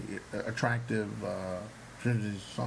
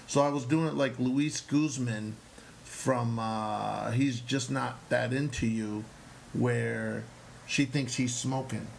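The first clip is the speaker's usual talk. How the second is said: ordinary talk, nothing marked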